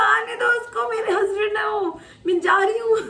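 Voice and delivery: high, loud tearful yell; screaming through tears at the guards; crying shout. Retold or imitated. To the guards blocking her husband, a tearful loud shout. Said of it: "इतने जोर से चिल्लाई मैं".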